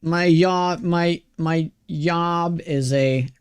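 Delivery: "job" said as "yob"; Swedish J-as-Y. Retold or imitated. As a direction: imitate a Swede, turning the J of "job" into Y. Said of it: "He pronounces J's like Y's".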